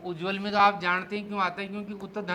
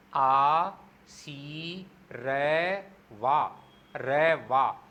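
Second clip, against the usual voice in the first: drawn-out syllables, आ सी रै वा, each split apart; slow syllable by syllable; 5 semitones lower, much slower than usual. Shown as a demonstration. Teacher showing students how to sound out आशीर्वाद, very slowly syllable by syllable. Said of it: "धीरे धीरे".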